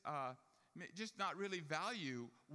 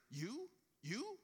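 rising higher and higher, stunned; shocked disbelief; 9 semitones higher than usual. Retold or imitated. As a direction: mimic the rabbi's stunned double take. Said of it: "He was really shocked".

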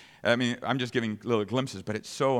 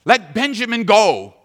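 desperate plea; 8 semitones higher, 11 dB louder than usual. Acted. Brothers plead for Benjamin.

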